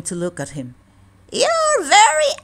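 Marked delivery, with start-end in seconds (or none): 1.3-2.3 mocking geese. A narrator's reading. Wild geese taunting the duckling, high and jeering. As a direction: sneer it like a haughty goose. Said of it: "they said".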